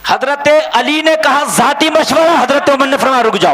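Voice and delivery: loud, high-pitched dramatic sermon telling; ringing preacher's shout; full-force preaching. Real, not acted. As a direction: proclaim the story to the crowd.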